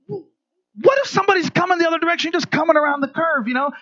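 high, rushed worry; acted alarm. Nervous passenger on a one-lane mountain road, acted alarm.

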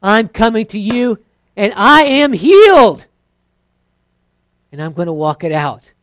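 mock bold faith declaration, loud. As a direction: declare it boldly. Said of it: "You might have the bold declaration".

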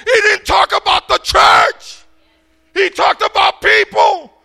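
preaching at full force. Acted. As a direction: build to a rousing climax. Preaching climax on people.

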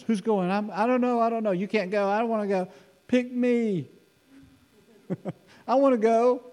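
high, excited squabbling angel voices; eager angels squabbling. Acted. Preacher acting angels eager to go, high squabble. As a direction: play bickering angels begging to be picked. Angels arguing over who goes.

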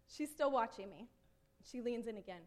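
ordinary talk, nothing marked

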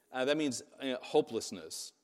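usual speaking voice

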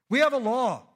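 the leaders pushing Pilate, loud; accusers' loud demand. A narrator's reading. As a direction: declare it like angry accusers.

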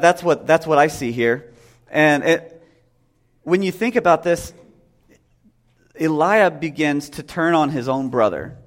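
normal talking voice

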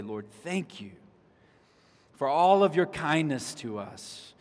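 earnest spoken prayer, rising in the middle, fading to a hush. Acted. Pastor praying over the congregation before the sermon, earnest prayer voice.

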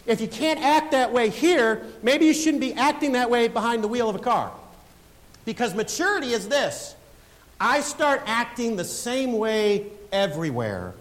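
loud, high, driving sermon climax; preaching at full force. Acted. Pastor exhorting the congregation, preaching at full force. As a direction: preach it hard, building to a peak.

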